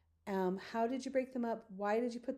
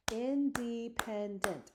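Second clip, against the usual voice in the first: independent drawn out slowly in four separate beats; syllable by syllable; 2 semitones higher, much slower than usual. Shown as a demonstration. Teacher counting syllables with students, slow separated beats. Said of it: "Let's count how many syllables we have".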